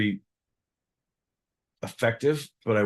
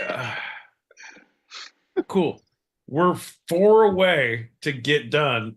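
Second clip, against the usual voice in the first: normal talking voice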